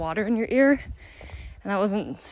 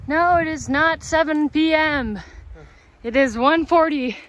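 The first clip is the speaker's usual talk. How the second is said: emphatic correction; 5 semitones higher, 6 dB louder than usual. Real, not acted. Correcting the time indignantly.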